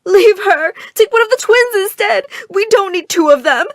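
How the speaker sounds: high, loud, melodramatic wail; hammed-up panicked crying out; loud crying plea. A narrator's reading. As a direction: beg the robbers at full pitch. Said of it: "started to cry loudly".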